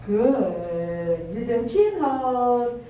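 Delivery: slow, drawn-out soothing praise; calm a dog with long, easy words. Real, not acted. Soothing praise to dog during hold.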